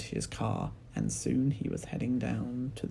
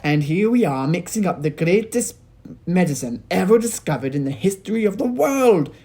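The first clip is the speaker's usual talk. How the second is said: indignant, excited Mister Cranky; 8 semitones higher, 12 dB louder than usual. A narrator's reading. Mister Cranky rants to his wife, high and loud. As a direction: rant with manic enthusiasm. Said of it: "cried Mister Cranky".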